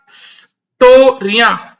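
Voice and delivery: स्टोरिया stretched out very slowly, each syllable separate; very slow sounding out. Shown as a demonstration. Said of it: "इसका जो अनंसिएशन है".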